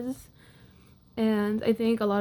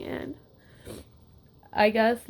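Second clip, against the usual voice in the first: ordinary delivery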